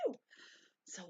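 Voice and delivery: usual speaking voice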